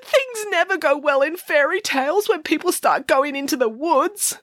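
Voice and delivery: high tearful worry; tearful Boris. A narrator's reading. Boris frets through tears about the woods.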